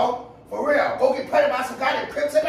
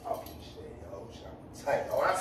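very soft, low grumble; upset muttering; 11 semitones lower, 13 dB quieter than usual. Real, not acted. Upset man stews over his ex, muttering low. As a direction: curse under your breath, stewing.

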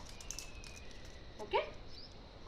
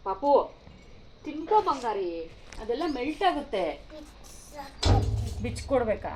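higher, louder coaxing mother voice; 5 semitones higher, 7 dB louder than usual. Real, not acted. Mother coaxing her small son to eat chocolate, higher and louder. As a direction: coax the little one to eat up.

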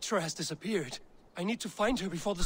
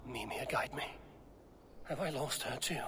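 whisper; 4 semitones lower, 9 dB quieter than usual. Acted. Whispered prayer to Mimir.

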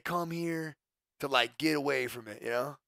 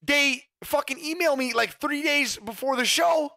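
higher and louder, fed-up complaint; indignant rant; 11 semitones higher, 8 dB louder than usual. Real, not acted. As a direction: vent the outrage, slowing at the end. Venting about oversold jersey.

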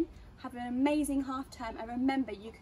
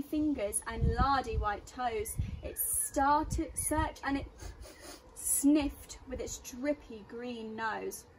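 put-on reading to children; 2 semitones higher, 6 dB louder, slower than usual. A narrator's reading. Reads a rhyming story aloud.

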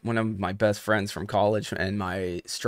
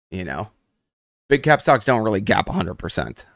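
usual speaking voice

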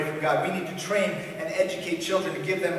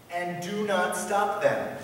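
very slow, deliberate quote; emphatic scripture command; 3 semitones higher, much slower than usual. Acted. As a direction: spell out the command slowly. Reads command back to objector.

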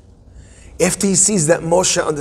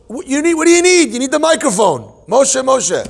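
much higher, playful mock staging; hammed-up high voice; 10 semitones higher, 3 dB louder than usual. Imagining God needing a microphone to call Moshe, high mock voice. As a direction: pitch it high, playing at a divine call.

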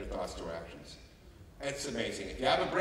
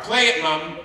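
put-on child's demand; 3 semitones higher, 9 dB louder, slower than usual. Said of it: "She gets in the car".

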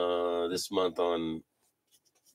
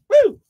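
loud high whoop of joy; 11 dB louder than usual. Real, not acted. Host whoops at a great pull, loud and high. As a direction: let out a big cheer. Cheering on stream.

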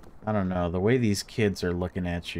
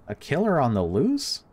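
higher, incredulous rising repeat; mock disbelief; 7 semitones higher, 3 dB louder than usual. Player rereads the odd greeting with amused mock disbelief. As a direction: replay it, amused at how odd it is. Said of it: "so weird to be seeing that phrase used as a greeting".

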